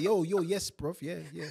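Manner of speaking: mock Nigerian London slang; a light Nigerian accent on yeah bruv. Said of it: "I came back like".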